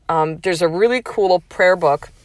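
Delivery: ordinary talk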